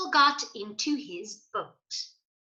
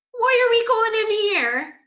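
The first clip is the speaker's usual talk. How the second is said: much higher, louder puzzled question; character voice; 12 semitones higher, 8 dB louder than usual. Acted. Gigi puzzled at the museum, high and loud.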